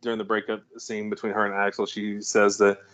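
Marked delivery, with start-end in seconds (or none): none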